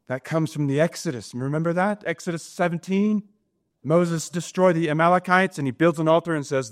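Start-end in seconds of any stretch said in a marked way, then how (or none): none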